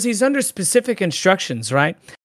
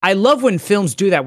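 ordinary delivery

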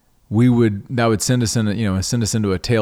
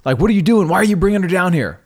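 high, louder irritated snapping; retold snap; 9 semitones higher, 3 dB louder than usual. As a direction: snap at her again, fast and sharp. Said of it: "snap at her again".

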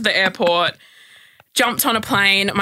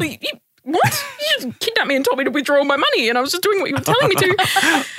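incredulous disbelief; 10 semitones higher than usual. Retold or imitated. Retorting to the taxi driver, disbelieving.

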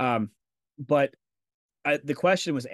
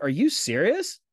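a retold mad question, a bit louder; imitating his own irritated disbelief; mad retort; 5 semitones higher, 5 dB louder, slower than usual. Fired worker to manager, a touch of retold anger. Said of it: "'Cause I was, I was mad".

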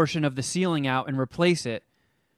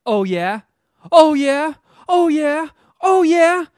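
building from a plain "Oh, yeah?" to a much higher, louder shout; mock argument escalating to shouting; escalating mock challenge; 11 semitones higher, 8 dB louder, slower than usual. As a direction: ramp up the taunt each time.

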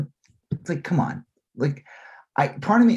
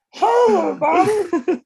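much higher, louder and slow falsetto call through laughter; squeaky high Batman, louder; 6 dB louder, slower than usual. Retold or imitated. Mocking the Batman voice as too high, loud squeaky falsetto. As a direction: belt the falsetto hello like a goofy mock Batman. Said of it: "it was weird when he was like".

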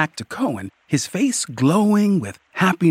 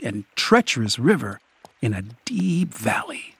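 ordinary delivery

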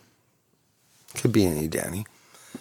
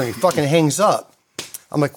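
ordinary delivery

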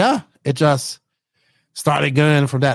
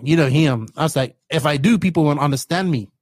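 normal talking voice

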